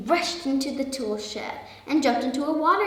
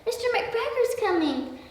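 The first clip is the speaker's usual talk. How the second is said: high-pitched little sparrow voice; urgent, high storybook pleading; urging; 12 semitones higher than usual. A narrator's reading. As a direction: urge him on in a high bird voice. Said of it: "urged some friendly sparrows".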